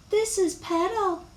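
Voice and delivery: put-on high little-girl voice; small child introducing a doll; Wemberly. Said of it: "said Wemberly".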